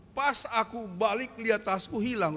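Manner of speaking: ordinary talk, nothing marked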